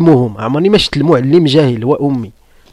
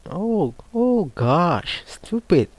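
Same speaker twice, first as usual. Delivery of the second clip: mock dismay; 3 semitones higher, 7 dB quieter, much slower than usual. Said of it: "But you say".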